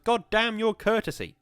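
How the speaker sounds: very high, very loud curse; furious shout. A narrator's reading. Woodcutter shouting down the polite visitor, furious. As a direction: scream it, cutting him off.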